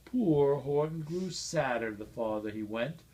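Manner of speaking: ordinary delivery, nothing marked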